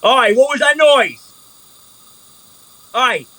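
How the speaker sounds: loud, high Oi; guard shouting. Acted. Dwarf guard shouting down at the noise below, loud. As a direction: bark it down from above.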